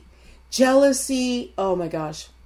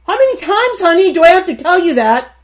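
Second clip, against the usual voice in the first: high, fast, exasperated nag; mock nagging spouse; exasperated nagging wife; 8 semitones higher, 7 dB louder, faster than usual. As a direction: scold like a fed-up wife repeating herself. Nagging wife voiced in sermon.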